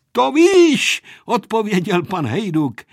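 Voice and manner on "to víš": high, loud hearty call; big raised-voice answer; shouted reply. A narrator's reading. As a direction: shout it back over the engine. Pilot shouts reply in flight.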